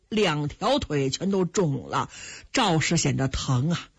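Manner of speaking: plain reading voice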